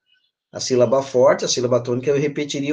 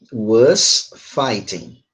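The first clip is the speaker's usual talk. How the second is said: very slow, lower, drawn-out words; slow modelling; 5 semitones lower, much slower than usual. Shown as a demonstration. Teacher sums up the answer for class, slow modelling. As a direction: dictate it word by word.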